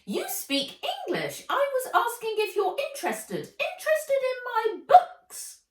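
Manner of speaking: very high, eager elf voice, pace picking up; a tiny merchant elf pitching her wares; squeaky elf. Acted. Elf selling books to Nat, high and squeaky. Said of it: "said the elf".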